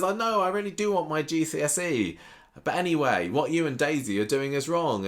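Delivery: hammed-up sulky girl; mock Lauren. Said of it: "She says".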